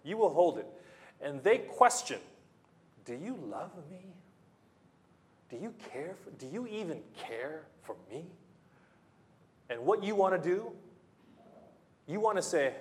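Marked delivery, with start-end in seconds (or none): 3.0-8.3 soft, wounded plea; hurt child. Acted. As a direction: ask like a doubting little child. Child questioning loving parent.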